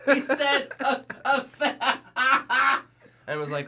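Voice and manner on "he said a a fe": very high, loud, laughing through words; laughing retelling. Retold or imitated. Lady telling passersby, high loud laughing. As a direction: gasp it out while cracking up. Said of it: "the lady like".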